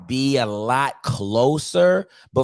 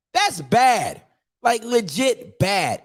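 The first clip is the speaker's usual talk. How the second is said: higher, stressing That's; emphatic disgust; 7 semitones higher, much slower than usual. Real, not acted. Host condemning the run defense, emphatic. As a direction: pile it on in disbelief. Repeated verdict.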